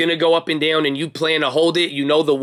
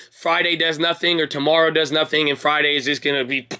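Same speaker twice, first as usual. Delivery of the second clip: ordinary delivery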